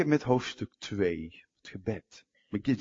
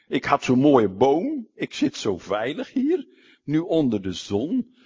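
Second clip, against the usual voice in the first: loud, indignant complaint; angry Jonah; 3 semitones higher, 7 dB louder than usual. Retold or imitated. Jonah protests to God over the tree, loud. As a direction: Jonah lamenting his lost shade, loudly. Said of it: "Jona vreselijk boos".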